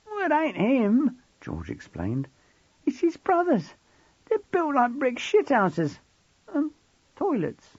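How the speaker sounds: put-on high, slow boy's voice; cockney teen boy. A narrator's reading. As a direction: talk as the streetwise boy. Voices George.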